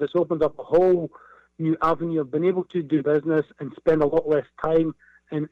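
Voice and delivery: normal talking voice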